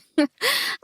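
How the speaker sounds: a quick bright laugh before speaking; brief amused laugh; short laugh. A narrator's reading. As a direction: laugh lightly, then talk.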